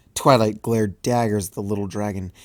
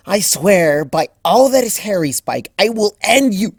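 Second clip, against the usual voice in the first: loud, higher, fierce mock threat; 11 semitones higher, 9 dB louder than usual. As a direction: threaten him with playful fury. Threatening Spike over diary teasing.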